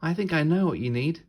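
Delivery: put-on high, fast eager girl; eager little girl. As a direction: blurt out the idea as an excited girl. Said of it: "said Red".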